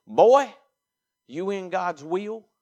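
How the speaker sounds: high, loud, drawn-out fatherly question; challenging daddy. Retold or imitated. Daddy confronting his rebel son, high, challenging call. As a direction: call the boy out, then stretch the question. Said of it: "from my daddy. He said".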